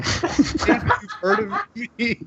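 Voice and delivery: high, slow, breaking into laughter; laughing wizard bluff. Acted. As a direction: try to stay in character while cracking up.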